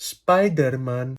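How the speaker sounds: put-on SPIDERMAN slow, in a heavy Greek accent; mock Greek accent. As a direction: spell out every sound of "Spiderman" the Greek way. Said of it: "με ελληνική προφορά".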